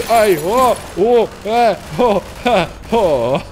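loud panicked cries; startled yelps. Real, not acted. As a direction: yelp at each sudden attack. Ambushed by an enemy.

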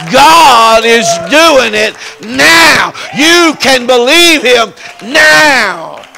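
shouting preacher climax. Real, not acted. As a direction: let it ring out like a revival climax.

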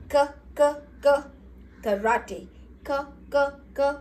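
K, K as a sharp karate k; k sound. Shown as a demonstration. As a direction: chop out K like karate. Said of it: "K makes the sound".

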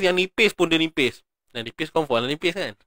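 ordinary talk

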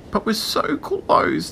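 high, slow tiny voice; drawn-out, gentle little plea; soft coaxing. A narrator's reading. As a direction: coax gently as a little shell. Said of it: "the voice said softly".

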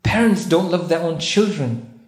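ordinary talk, nothing marked

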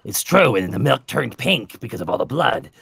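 usual speaking voice

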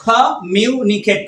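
slow, measured "communicate"; teacher's slow pronunciation; syllable by syllable. Shown as a demonstration. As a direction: ease through each syllable of "communicate". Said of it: "কয়টা হলো?".

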